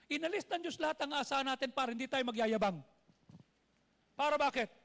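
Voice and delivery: loud high preacher's exhortation; preaching at full force. Acted. Pastor urging the congregation, loud fervent preaching. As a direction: preach it out to the crowd with fire. Preacher declaring glory to God.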